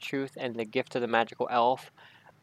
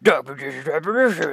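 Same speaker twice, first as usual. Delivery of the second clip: Dark Magician voice. Retold or imitated. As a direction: chant the name like a card monster. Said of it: "there you go, perfect".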